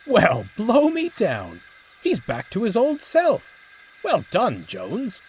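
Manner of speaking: high, loud hearty praise; delighted boss. A narrator's reading. Manager pleased Horace is back, delighted. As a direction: burst with relief and joy.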